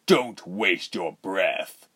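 slow, drawn-out villain warning; snapped. Acted. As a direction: warn with restrained irritation. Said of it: "Reuben snapped".

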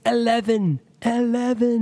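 high, imitating a Scottish "eleven"; Scottish accent. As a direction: mimic the skit's Scottish accent. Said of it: "like, with an accent".

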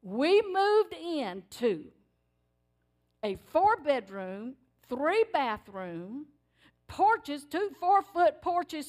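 slow, drawn-out listing of each room; savoring slowly. Real, not acted. Preacher savoring the house God gave, slow and drawn out. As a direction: stretch every word with wonder. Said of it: "savoring each house detail".

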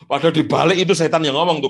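ordinary talk, nothing marked

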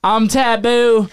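high, slow, drawn-out stage intro; slow, high, hammed-up rapper intro; mock intro. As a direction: stretch it out like a hype MC. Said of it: "I'm like".

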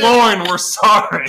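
loud, high call of apology; playful shouted plea; mock apology. Mock apology called to absent person.

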